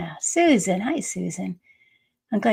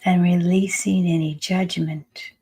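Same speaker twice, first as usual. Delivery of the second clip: unhurried meditation voice with long pauses; calm, spacious meditation guiding; guided meditation. Acted. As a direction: lead them into the breath, phrase by phrase. Leads a breathing meditation.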